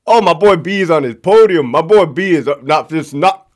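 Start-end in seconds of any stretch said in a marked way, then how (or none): none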